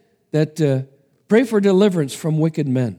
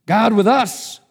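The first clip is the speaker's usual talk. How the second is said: stunned, higher disbelief; can't believe what he reads; 8 semitones higher, 4 dB louder, slower than usual. Acted. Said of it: "in a tone of disbelief".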